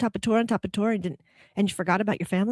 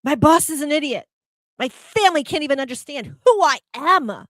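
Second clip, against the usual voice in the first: higher, louder, fed-up griping; mock complaint; 8 semitones higher, 5 dB louder than usual. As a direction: gripe like someone blaming everyone. Mock complaints of angry veteran.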